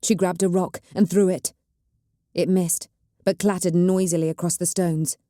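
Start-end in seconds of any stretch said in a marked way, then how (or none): none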